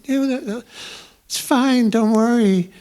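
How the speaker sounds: playful high impression of a shy superstar. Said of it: "It was, it was [name]".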